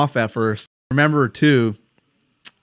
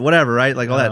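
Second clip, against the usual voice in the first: ordinary delivery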